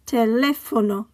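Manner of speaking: Teléfono with LÉ stressed; stress on accented syllable. Shown as a demonstration. Teacher modelling written accents, Teléfono stressed on LÉ. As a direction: say Teléfono in Spanish, te-LÉ-fo-no. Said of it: "stress the syllable that the accent is on".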